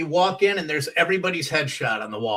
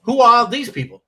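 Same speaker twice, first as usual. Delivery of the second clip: much higher, louder put-on voice; Paul Reiser impression; 8 semitones higher, 8 dB louder than usual. Comic does Paul Reiser bit to crack up Judy. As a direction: push it up into a Reiser whine. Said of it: "do Paul Reiser".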